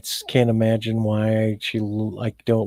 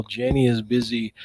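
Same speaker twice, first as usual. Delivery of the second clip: ordinary talk, nothing marked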